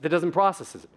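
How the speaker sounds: normal talking voice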